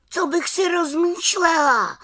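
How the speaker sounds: high little-witch cry of protest; exclaimed. A narrator's reading. Little witch crying out to the raven, high exclamation. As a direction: fling the question back, high and bright. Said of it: "vykřikla malá čarodějnice".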